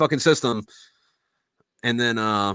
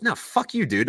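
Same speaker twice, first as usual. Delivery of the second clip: higher-pitched, softer mock refusal; defiant retort; 5 semitones higher, 5 dB quieter than usual. Drunk buddy refuses to leave the shower, defiant retort. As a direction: refuse in a high retold voice. Retold quarrel over the shower.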